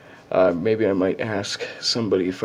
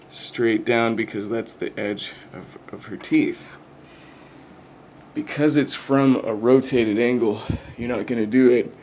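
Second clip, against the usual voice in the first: ordinary talk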